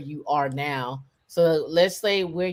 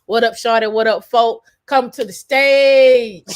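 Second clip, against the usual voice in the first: loud, raised hype-host shout, last word stretched; Atlanta-style hype welcome; 5 semitones higher, 9 dB louder than usual. Pretend. Host brings a friend to the stage, Atlanta-style hype welcome. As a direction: holler a guest up like an Atlanta emcee. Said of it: "A T L style welcome".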